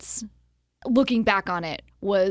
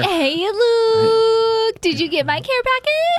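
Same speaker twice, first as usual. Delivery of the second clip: overjoyed saleswoman squealing hello; gushing greeting; 13 semitones higher, 7 dB louder than usual. Acted. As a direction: greet them in a long high singsong. Gushing caller greets Luke.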